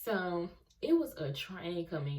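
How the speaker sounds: ordinary delivery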